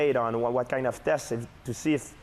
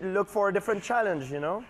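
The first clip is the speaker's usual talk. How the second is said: higher-pitched imitation of the host; Michael Landsberg; 7 semitones higher than usual. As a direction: keep up the pretend host voice. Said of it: "you go back to being [name]".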